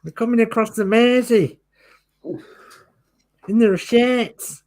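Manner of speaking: high, loud, hammed-up Scouse; mock alarm in a badly faked Liverpool accent; awful Scouser accent. Host carries on the wartime joke in a silly Scouse accent. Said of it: "that's my awful, um, Scouser accent".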